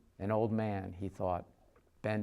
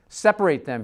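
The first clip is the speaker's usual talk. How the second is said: loud, higher command to the guards; barked order; 7 semitones higher, 12 dB louder than usual. Acted. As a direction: shout it over the chaos.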